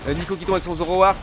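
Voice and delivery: ordinary talk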